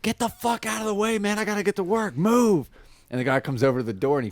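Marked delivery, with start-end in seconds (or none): 0.0-2.7 higher mock road-rage yelling; stuck driver yelling to get past; mock angry yell. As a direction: yell it like you're late for work. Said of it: "He's like".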